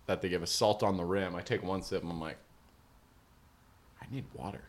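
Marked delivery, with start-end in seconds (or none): 4.0-4.6 very soft, understated mumbled request; not urgent. Retold or imitated. About to faint, asks for water, understated and quiet. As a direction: ask weakly, barely aloud. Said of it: "not urgent".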